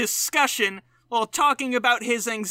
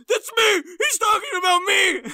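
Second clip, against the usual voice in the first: much higher, half-crying shriek; shocked outcry; 10 semitones higher, 3 dB louder than usual. Retold or imitated. Host at home hearing himself in the special, shocked shriek. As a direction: squeal in horrified recognition. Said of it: "I'll be at home like".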